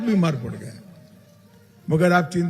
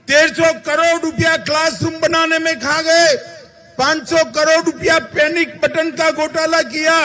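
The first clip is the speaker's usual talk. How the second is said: high, loud, ringing rally voice; raised rally shout; 9 semitones higher, 3 dB louder than usual. Acted. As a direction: hammer out each scam figure to the crowd. Listing scams to rally crowd.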